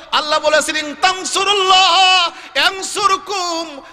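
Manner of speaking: high, ringing sermon chant; full-force preaching. Acted. Preacher recites God's promise of help, high fervent preaching. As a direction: proclaim the promise high, easing down at the end.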